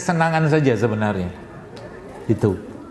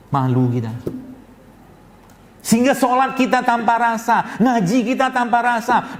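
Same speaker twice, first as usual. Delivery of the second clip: raised pitch, driving sermon delivery; fiery preaching; 6 semitones higher than usual. Acted. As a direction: preach it with rising fervour. Preacher rebukes congregation fervently. (ceramah berapi-api dengan nada tinggi)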